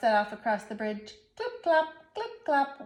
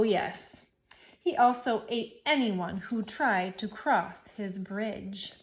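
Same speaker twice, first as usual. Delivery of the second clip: plain reading voice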